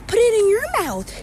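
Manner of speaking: put-on high, loud little kid. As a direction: squeak it like a small child. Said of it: "And he's like".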